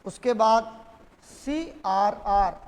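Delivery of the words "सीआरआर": very slow, drawn-out letters; dictating. Real, not acted. Teacher listing policy tools to students, slow dictation. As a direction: spell it out slowly for note-takers.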